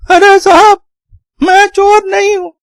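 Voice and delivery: very high, strained character voice; pleading labourer. Acted. Caught labourer explains himself to the household, high distressed pleading. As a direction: plead innocence in a high, tearful wail.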